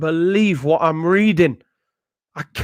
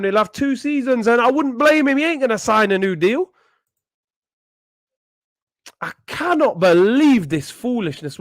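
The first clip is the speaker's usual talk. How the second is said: usual speaking voice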